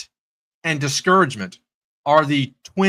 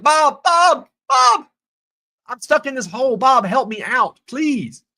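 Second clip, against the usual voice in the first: acted desperate calling; 10 semitones higher, slower than usual. Man in a hole begs buddy Bob, mock-desperate shout. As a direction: holler up to a friend, hammed-up.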